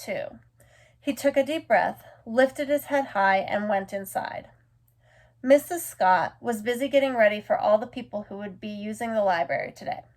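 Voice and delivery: plain reading voice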